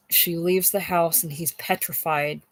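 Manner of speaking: usual speaking voice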